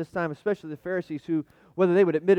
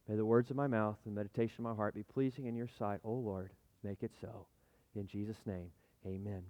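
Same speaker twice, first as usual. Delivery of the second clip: soft, fading closing prayer; hushed prayer; 7 semitones lower, 12 dB quieter than usual. Real, not acted. Pastor closes his prayer before the sermon, hushed. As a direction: let the prayer sink to near silence.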